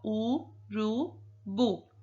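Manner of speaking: "urubu" drawn out syllable by syllable, u-ru-bu; slow syllables. Shown as a demonstration. Teacher sounding out "urubu" slowly for the child. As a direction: stretch "urubu" so each syllable is heard. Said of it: "quando nós falamos, ó".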